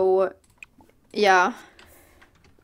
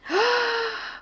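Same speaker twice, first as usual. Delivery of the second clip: gasp; 12 semitones higher than usual. Real, not acted. Sudden surprise at pet.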